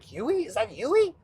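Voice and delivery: imitated delighted surprise; Michael J. Fox. Michael spotting his old friend, surprised. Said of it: "the look on Michael J. Fox's face".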